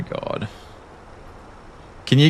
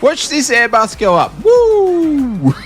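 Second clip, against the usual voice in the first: high falsetto rising into a louder whoop; silly high voice with a big "woo"; comic character dub; 4 dB louder, much slower than usual. Acted. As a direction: squeal it, then whoop higher. Voicing figure in video clip.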